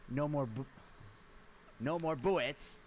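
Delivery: cartoon voice, starting over and drawing it out; Elmer Fudd. Shown as a demonstration. Narrator repeating the impression as the husband's reply, cartoon voice. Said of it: "I have to say it again".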